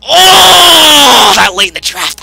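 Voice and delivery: loud, high anguished wail; crying out in mock agony. Real, not acted. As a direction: groan at the missed chance. Missed a great late pick.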